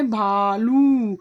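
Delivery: भालू stretched very slow with a falling close; teacher stretching the long vowel for the class; drawn-out answer. Shown as a demonstration. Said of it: "क्या होता है?".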